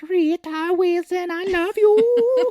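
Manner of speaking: very high, loud, drawn-out character wail; hammed-up goofy declaration of love; lovesick howl. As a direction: croon it shrieking, stretched way out. Acted lovesick Adam Sandler character.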